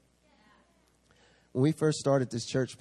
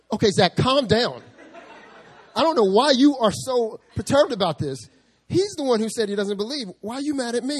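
higher, louder, laughing plea; calm him down while laughing; flustered calming; 10 semitones higher, 7 dB louder than usual. Retold or imitated. Pastor pleading with upset Zach, high and loud. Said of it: "I was like".

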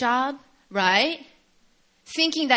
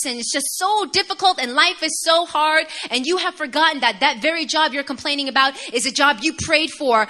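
louder, higher building rebuke; preaching; 6 semitones higher, 6 dB louder than usual. Acted. Preaching rises to full force.

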